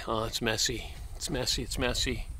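ordinary delivery

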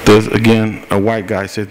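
ordinary delivery

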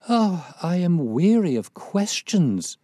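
loud, high, drawn-out impatience; impatient cry. A narrator's reading. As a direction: cry out wearily, stretching the words high. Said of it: "cried Miss Cushing impatiently".

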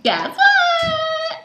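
high squealing tease; excited shout. Acted. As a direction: shriek it out with glee. Big reveal to viewers.

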